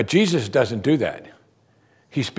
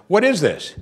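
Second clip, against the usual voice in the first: higher-pitched, incredulous acted questioning; amazed; 6 semitones higher than usual. Crowd debating the new teaching among themselves, acted amazement. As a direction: voice the crowd's astonishment, pitch raised. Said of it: "they were all amazed".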